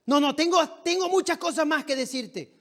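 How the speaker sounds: high-pitched heated retort, acted out; retold heated venting. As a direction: imitate a young man still venting his anger. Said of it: "él desahogándose y diciéndome todo".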